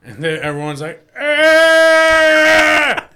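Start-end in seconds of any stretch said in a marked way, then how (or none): none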